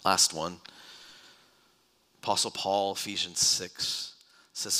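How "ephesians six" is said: ordinary delivery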